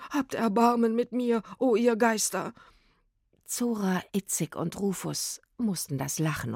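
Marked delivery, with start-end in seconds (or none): 0.1-2.5 higher, pitiful begging; whining supplication; wailing plea. A narrator's reading. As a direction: beg the spirits for mercy. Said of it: "jammerte er".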